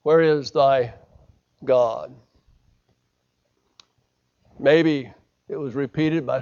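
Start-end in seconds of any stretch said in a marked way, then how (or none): 0.0-2.1 put-on lightly slurred, low drunken mumble; a hint of a drunk slurring. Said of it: "saying in a slurred voice".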